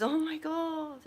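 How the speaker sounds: high, slow, drawn-out gasp of shock; a scholar horrified to find himself on fire; mock horror; shocked master. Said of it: "And he goes".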